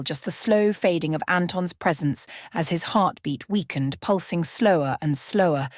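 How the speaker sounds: ordinary delivery, nothing marked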